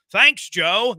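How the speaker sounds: lightly voiced sideline reporter; announcer impression. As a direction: toss it back to the booth, announcer-style. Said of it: "the A's announcer sounds like Susie Colbert".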